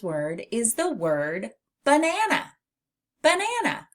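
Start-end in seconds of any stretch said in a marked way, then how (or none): 1.8-3.9 "Banana" swooped up high; cheery high "Banana" for kids; bright high model. Shown as a demonstration. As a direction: sing "Banana" high and clear. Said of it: "modeling listening word".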